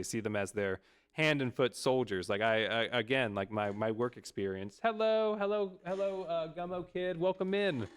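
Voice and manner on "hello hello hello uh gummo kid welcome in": sing-song greeting. Real, not acted. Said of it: "We're complaining about boomers at the moment".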